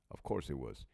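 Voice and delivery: hushed aside; conspiratorial whispered aside. Real, not acted. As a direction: whisper it like a secret.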